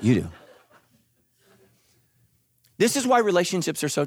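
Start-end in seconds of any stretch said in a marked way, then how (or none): 0.0-0.3 low, soft deadpan insistence; tired knowing mutter; weary friends. Retold or imitated. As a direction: sigh it out to someone in denial. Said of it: "Everybody in your life is like".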